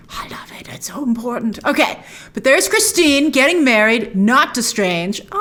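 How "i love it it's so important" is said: hushed whisper to the viewers. Real, not acted. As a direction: breathe it like a secret. Aside gushing about the villain.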